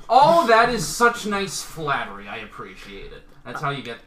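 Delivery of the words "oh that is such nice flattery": loud, high-pitched, slowed-down gush; delighted gushing. Real, not acted. Host thanking a contestant for praising the game show, gushing. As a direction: lay on the thanks, loud and drawn out. Host gushes over a compliment.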